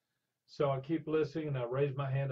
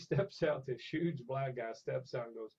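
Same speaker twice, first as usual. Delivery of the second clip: usual speaking voice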